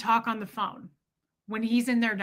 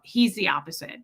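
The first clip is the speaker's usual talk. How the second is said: ordinary talk, nothing marked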